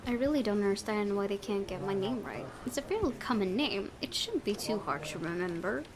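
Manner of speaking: ordinary talk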